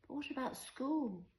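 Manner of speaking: put-on high-pitched small girl; little child's voice. A narrator's reading. Five-year-old asks her mother about school, little child's voice. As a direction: ask it high, as a young child.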